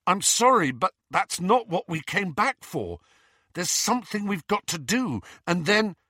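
high, pressured explanation; rising panic. A narrator's reading. Harry refusing the role they expect, rising panic. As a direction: explain anxiously, pitch held high. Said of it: "with a rising sense of panic".

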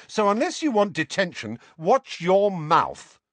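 high threat slowing to a firm finish; angry warning. A narrator's reading. Ron threatens Seamus with detention, angry warning.

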